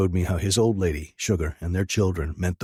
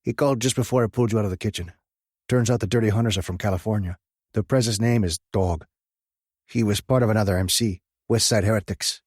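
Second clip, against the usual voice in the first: lightly voiced, higher biker buddy; a hint of an urgent friend; 4 semitones higher, faster than usual. Track reporting news.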